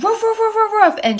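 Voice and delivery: high yappy woof woof barks; imitating dog barks. Telling the story, she mimics her dog barking high. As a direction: bark it out high like an excited golden retriever. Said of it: "my dog just goes woof".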